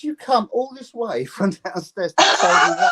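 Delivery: high, loud, incredulous with a laugh; laughing disbelief. Retold or imitated. Musician mocking a heckler, high and laughing. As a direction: laugh at the heckler's wasted effort. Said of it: "I said".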